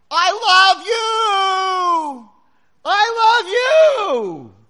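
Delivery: long, high, drawn-out holler; joyous-message canyon shout. Acted. Person projecting love into the canyon, hollering. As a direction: bellow it slowly, then echo it back. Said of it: "this kid screams across the cavern".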